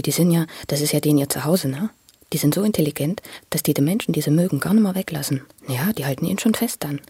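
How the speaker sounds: usual speaking voice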